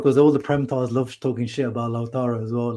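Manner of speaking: usual speaking voice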